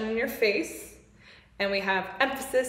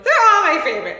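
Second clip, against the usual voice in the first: laughing, louder and higher; 7 semitones higher, 8 dB louder, much faster than usual. Real, not acted. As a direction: blurt it out through a laugh. Laughing admission.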